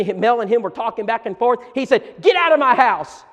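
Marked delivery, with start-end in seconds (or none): 2.2-3.2 louder, higher retold roar; angry father. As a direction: throw the man out of your house. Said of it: "got so angry".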